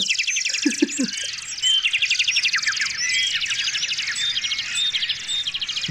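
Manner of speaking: breathless high wheeze of laughter; silent wheezing laugh. Real, not acted. Laughs at loud bird sound.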